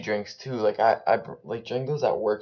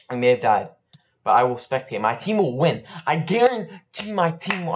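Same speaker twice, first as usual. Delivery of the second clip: 1 defiant boast; 5 semitones higher, 7 dB louder than usual. Real, not acted.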